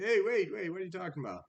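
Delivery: high, startled mock protest, loud at first; protesting goat. Medium-sized goat stopping the troll, acted protest. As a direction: burst out high, then ease off.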